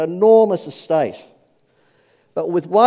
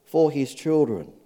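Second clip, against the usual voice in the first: ordinary talk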